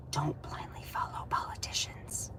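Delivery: breathy whisper into the camera. Real, not acted. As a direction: lean in and whisper it. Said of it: "I just did a whisper into the camera".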